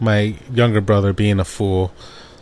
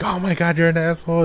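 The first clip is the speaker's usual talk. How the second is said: mock outrage; 8 semitones higher, faster than usual. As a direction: imitate an exasperated sister. Acting sister's outrage at prank.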